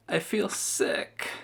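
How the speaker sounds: whispered, weak and sick; weakly. A narrator's reading. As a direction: barely get the words out. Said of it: "said Sarah weakly".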